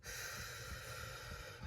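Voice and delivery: long unvoiced sigh of despair; heavy sigh. Real, not acted. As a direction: let out a long, weary sigh. Sigh after feeling defeated.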